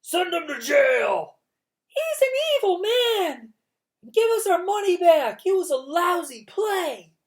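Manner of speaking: very high, loud jeering yells; angry audience calling for jail, high and loud; angry crowd shouts. A narrator's reading. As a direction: jeer and yell from the crowd. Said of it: "other people took up the cry".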